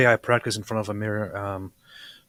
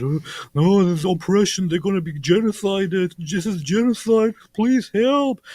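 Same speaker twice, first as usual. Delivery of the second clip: much higher, louder mock wailing plea; mock pleading; 9 semitones higher, 5 dB louder than usual. Host mocking protesters worldwide, high hammed-up plea. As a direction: caricature a pleading crowd, rising higher. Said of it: "are talking about".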